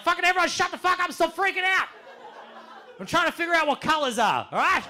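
high, loud, fast outburst; exasperated yell. Retold or imitated. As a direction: shout down the panicking group in an acted frenzy. Yelling at panicking friends to shut up.

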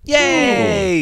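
cheering. Real, not acted.

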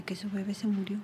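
doctor's words in a hushed unvoiced whisper; whispered news. Quoting the doctor telling her the baby died, whispered. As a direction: breathe the news out barely audible.

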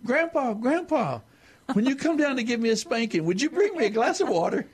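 put-on high child's voice calling out; little boy yelling. The boy yells to grandpa from his room, high and sweet. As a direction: yell it sweetly like a small boy. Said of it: "hears the little boy yell out".

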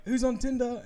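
imitating a host's bit; mock host bit. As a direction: lightly play the host.

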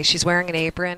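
usual speaking voice

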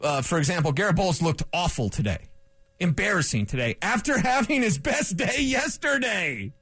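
usual speaking voice

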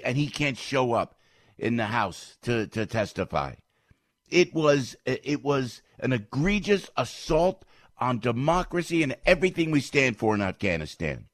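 usual speaking voice

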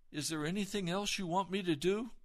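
high, loud, imploring question; urgent plea. Retold or imitated. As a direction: call after someone who is leaving. Pleading as God turns to leave.

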